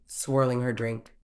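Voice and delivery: plain reading voice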